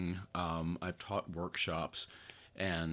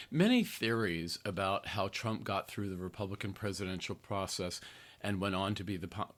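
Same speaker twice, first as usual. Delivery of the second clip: ordinary talk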